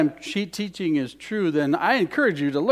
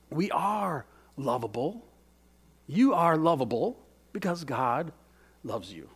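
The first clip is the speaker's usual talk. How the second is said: tender assurance; 7 dB quieter than usual. Acted.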